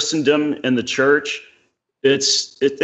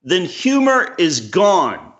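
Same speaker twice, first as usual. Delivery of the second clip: higher, slow, weighty quoting voice; slow, raised voice quoting Cleese to his friend; 6 semitones higher, slower than usual. Retold or imitated. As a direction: lay out Cleese's words slowly and gravely. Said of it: "and he, he said".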